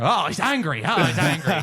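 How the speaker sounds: hammed-up Australian commentary; Steve Irwin. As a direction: exclaim like a thrilled Australian wildlife host. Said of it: "I would be Steve Irwin".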